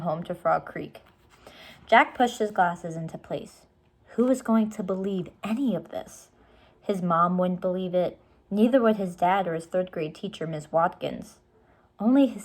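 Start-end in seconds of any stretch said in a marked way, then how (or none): none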